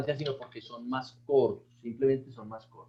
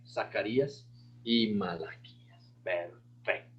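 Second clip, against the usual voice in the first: slow, hushed close of the list; whisper; 6 semitones lower, 6 dB quieter, slower than usual. Real, not acted. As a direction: drop to a whisper at the end. Recitation ends in whisper.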